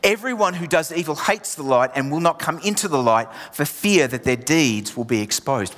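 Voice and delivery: ordinary talk, nothing marked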